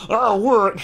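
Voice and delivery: loud high thunderous cry with a laugh; thundering. A narrator's reading. Hagrid thundering at Harry's ignorance, loud. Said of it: "Hagrid thundered".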